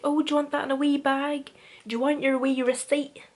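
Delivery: hammed-up Northern Irish shopkeeper offering a wee bag; mock Northern Irish shop assistant. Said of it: "how Northern Irish do I not sound".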